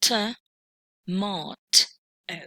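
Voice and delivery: tomato broken into three separate syllables, "T, mo, t, o". Shown as a demonstration. Teacher showing learners the three syllables in tomato, said piece by piece. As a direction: sound out "T, mo, t, o" with a gap between each syllable. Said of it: "this word contains three syllables".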